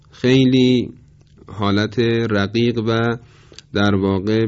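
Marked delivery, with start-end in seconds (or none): none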